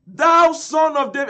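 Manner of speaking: high, loud cry. Retold or imitated. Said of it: "he cried the more a great deal".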